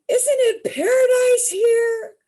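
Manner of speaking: high, loud, slow blissful gush; blissed-out husband. Retold or imitated. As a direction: gush it like the happiest man alive. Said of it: "he's like this".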